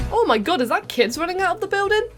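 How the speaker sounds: ordinary talk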